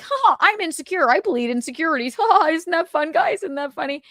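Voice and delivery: high, mocking, laughing voice; hammed-up taunt of a self-pitying friend; mock Carl. Mocking friend Carl about his insecurities, laughing high. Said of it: "Carl's like".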